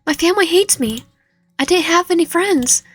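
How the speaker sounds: high, strained pleading voice; unstable character. Acted.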